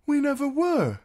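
put-on very high, loud boyish denial; blurting boy. A narrator's reading. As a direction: protest like an anxious-to-explain lad. Hot Pie blurts out a denial.

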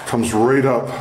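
usual speaking voice

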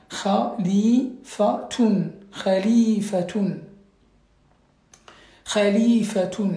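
Khalifatun spelt out slowly with a guttural kh, each syllable clear, ending tun; slow model pronunciation. Shown as a demonstration. Teacher to pupils, slowly modelling Khalifatun with guttural kh. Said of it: "Et donc c'est Khalifatun".

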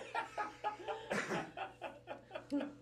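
real high giggling laugh. Real, not acted. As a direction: break up laughing. Laughing at own mama impression.

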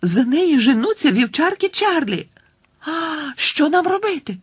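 high panicked cry; frightened. Acted. Girl panicking to her friend about the chasing dogs, high and scared. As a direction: panic as the dogs give chase. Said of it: "перелякано крикнула Тіна".

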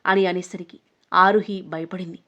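ordinary delivery, nothing marked

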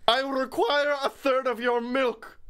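a pompous fairy making demands; Puka voice. Pretend. Voicing the Puka's demands.

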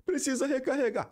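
high, falling whine of a dubbed hero; imitating a scared dubbed character; mock crying dub line. Gamer mocking the dub, crying hero in front of a demon. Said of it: "o cara vai chorar".

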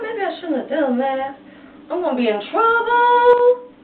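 worried Judas. Acted. Judas voiced in the story.

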